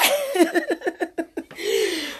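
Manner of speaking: high-pitched laughter in quick pulses; high laughing fit. Real, not acted. Still laughing at own joke, high laughing fit. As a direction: crack up high and helpless.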